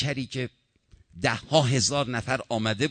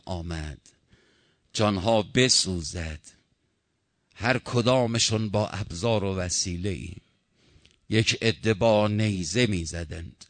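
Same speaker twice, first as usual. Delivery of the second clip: mournful lament; 4 semitones lower than usual. Acted. Preacher mourning Hussein for the congregation, a low lament.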